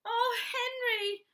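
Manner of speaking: high, slow, tearful plea; crying out. Acted. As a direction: wail it to your husband. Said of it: "she cried".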